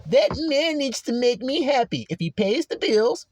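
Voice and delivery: mock her demanding tone; mocking the sister.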